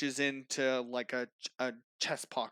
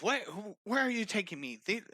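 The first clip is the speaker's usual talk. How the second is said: higher, stammering protest; struggling captive; 7 semitones higher than usual. Acted. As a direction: protest as you are hauled away. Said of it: "he's struggling along the whole way".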